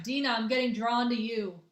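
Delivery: usual speaking voice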